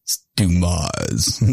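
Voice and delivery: deadpan correction, drawn out; correct pronunciation. Retold or imitated. Retelling the ad's interviewer correcting him, slow "Dumas". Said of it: "the whole advertisement".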